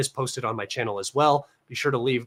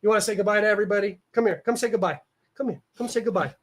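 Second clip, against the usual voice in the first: coaxing pet voice; 7 semitones higher, faster than usual. Real, not acted. Coaxing cat to say goodbye.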